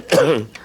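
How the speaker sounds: a heavy, wet cough from illness; sick cough. Acted. Sick man coughing before calling his partner.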